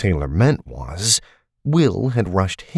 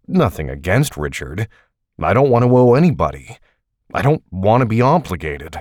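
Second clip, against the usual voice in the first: louder, fast, refusing to owe anyone; heated refusal; 3 semitones higher, 4 dB louder than usual. Acted. Taylor angrily refusing to borrow from Richard, raised and fast. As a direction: push back hard and fast, voice raised.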